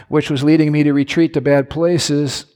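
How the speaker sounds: ordinary talk, nothing marked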